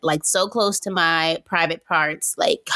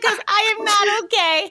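mock crying; 14 semitones higher than usual. Retelling telling her boyfriend she's hurt, mock wail. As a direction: whine it out half laughing, half crying. Retold complaint to boyfriend, laughing.

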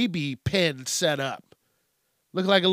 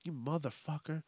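very soft grumble under the breath; acted mumbling; 15 dB quieter than usual. Annoyed customer at parents with a baby, mumbling. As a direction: mumble it barely audibly, as if to yourself. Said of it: "Probably mumbling something".

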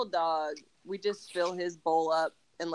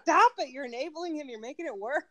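scolding; 9 semitones higher than usual. Retold or imitated. Scolding partner over spoiled dog.

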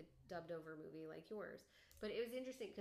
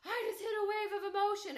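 put-on child's voice; 12 semitones higher, 14 dB louder, faster than usual. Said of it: "And he's like".

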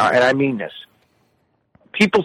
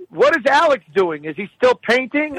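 higher-pitched mock mother; nosy old mother; 8 semitones higher than usual. Mother asking son about Alex, high querying voice. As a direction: imitate a mother asking after family. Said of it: "or she'll go".

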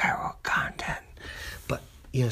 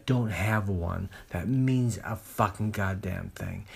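ordinary talk

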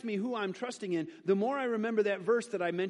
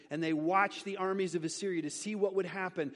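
ordinary delivery

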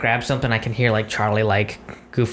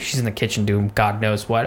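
usual speaking voice

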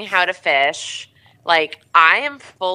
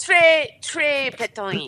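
put-on French accent; 7 semitones higher than usual. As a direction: play a Frenchman gushing. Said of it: "French person".

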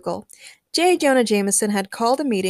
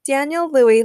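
plain reading voice

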